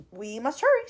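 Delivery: loud, urgent call; excited cry. A narrator's reading. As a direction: hurry them along excitedly. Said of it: "he cried, clapping his hands once".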